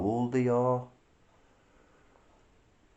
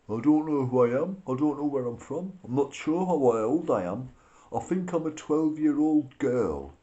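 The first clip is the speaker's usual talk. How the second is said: a mocking, higher-pitched voice that plays dumb, a bit louder; mock pleading ignorance; 5 semitones higher, 4 dB louder than usual. Vlogger mocks migrants who plead ignorance, in a mock innocent voice. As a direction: play the innocent asylum seeker who knows nothing. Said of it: "plead ignorance".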